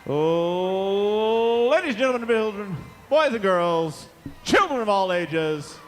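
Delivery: booming ring announcer, building to a roar; grand entrance announcement. Real, not acted. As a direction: work the crowd like a boxing MC. Announcing the winning team.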